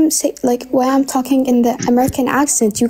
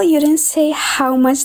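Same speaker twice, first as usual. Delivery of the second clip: normal talking voice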